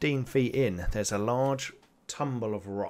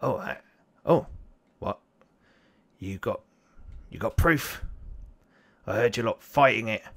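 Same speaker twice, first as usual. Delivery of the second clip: a shifty goblin demanding proof; 5 dB louder than usual. Acted. GM voices goblin.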